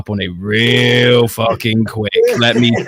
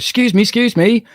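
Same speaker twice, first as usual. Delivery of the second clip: high-pitched, imitated call for attention; pestering stranger; 9 semitones higher than usual. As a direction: pipe up like an insistent passer-by. Stranger tries to get her attention.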